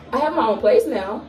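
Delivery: normal talking voice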